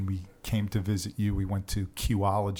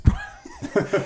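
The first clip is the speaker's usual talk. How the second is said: laugh; 11 semitones higher, 5 dB louder than usual. Real, not acted. Amused after a joke.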